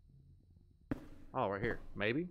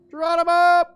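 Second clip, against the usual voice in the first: much higher, much louder yell as he leaps; jump yell; 13 semitones higher, 12 dB louder than usual. Real, not acted. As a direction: shout it out going over the edge. Jumping off a height in game.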